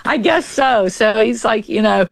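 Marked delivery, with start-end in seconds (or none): 0.0-0.9 laughing. Retold or imitated. Retelling dad's joking reply.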